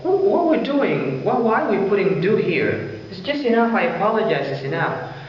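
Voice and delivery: high-pitched puzzled questioning; self-questioning. Acted. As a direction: voice a doubting learner, pitched well up. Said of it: "if you ask yourself".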